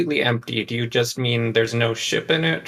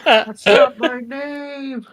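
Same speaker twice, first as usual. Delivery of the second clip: high, loud, drawn-out shout; calling back; 12 semitones higher, 5 dB louder, slower than usual. Pretend. Player answering the yelled wrong name, high loud call back. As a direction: holler back slowly at a high pitch.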